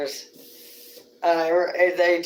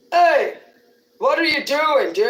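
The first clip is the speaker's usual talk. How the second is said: much higher, louder call across the street; 8 semitones higher, 8 dB louder than usual. Retold or imitated. A stranger on the street calls out to Zeke, loud. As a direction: holler at him from a distance.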